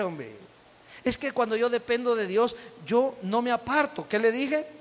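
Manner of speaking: usual speaking voice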